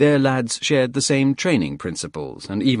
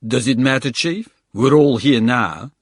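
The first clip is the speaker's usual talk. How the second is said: put-on South African accent; 3 semitones higher, 5 dB louder than usual. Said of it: "South African accent".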